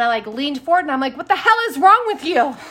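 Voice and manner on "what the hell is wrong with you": high, loud, half-laughing scold; mock mom scolding. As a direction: tell him off like an outraged mom, half laughing. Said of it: "I went all mommy on him".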